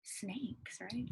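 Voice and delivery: breathy stage whisper, barely audible; whispered answer. Acted. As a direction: let the answer slip out as a secret. Teacher reveals answer to class.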